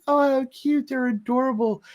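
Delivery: high-pitched cooing over cute babies; gushing manager. Retold or imitated. Manager fawning over the twins, high gushing coo. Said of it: "the manager looks at the twins and goes".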